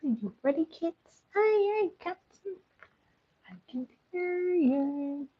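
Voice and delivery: put-on high, playful captain rallying the kids; captain's call-and-response. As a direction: call it out high, stretching the last line. Acts out a pirate captain's call-and-response.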